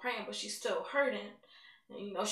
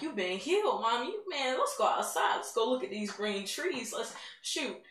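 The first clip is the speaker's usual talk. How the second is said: louder, thrilled, tumbling out; excited shouting; 3 semitones higher, 4 dB louder than usual. Retold or imitated. Daughter rushing healed mother outside, thrilled and loud. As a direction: urge her outside in delight.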